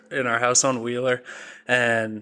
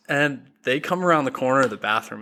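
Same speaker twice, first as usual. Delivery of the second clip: ordinary delivery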